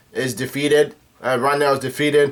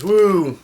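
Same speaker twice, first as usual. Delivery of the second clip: high-pitched "woo"; imitated whoop; 6 semitones higher than usual. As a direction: let out a mock whoop, pitched up. Chopper whoops, acted.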